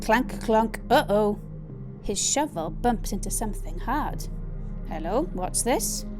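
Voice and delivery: usual speaking voice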